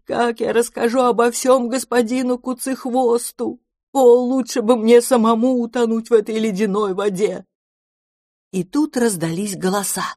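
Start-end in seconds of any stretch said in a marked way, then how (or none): 0.1-7.5 рыдая. A narrator's reading. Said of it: "громко зарыдал".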